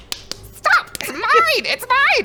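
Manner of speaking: very high, loud pixie shriek; possessive whining. Acted. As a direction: screech it like a tiny thief. Pixie grabbing pouch back.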